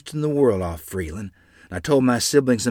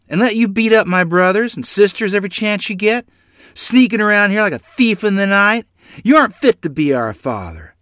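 high, loud accusations hurled at him; a son yelling at his father in the road; furious yell; 12 semitones higher, 8 dB louder than usual. Acted. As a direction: let it all out at the man. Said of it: "I yelled".

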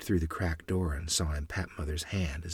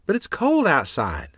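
put-on child's protest; 10 semitones higher, 6 dB louder than usual. A narrator's reading.

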